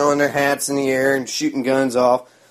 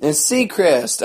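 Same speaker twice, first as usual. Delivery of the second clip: ordinary talk, nothing marked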